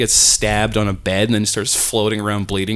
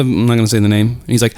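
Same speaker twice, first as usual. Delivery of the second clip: usual speaking voice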